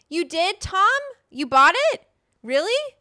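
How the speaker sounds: high, loud delighted squeal of surprise; excited disbelief. Real, not acted. Thanking viewer Tom for buying the cookbook, high excited squeals. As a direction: squeal it out, thrilled.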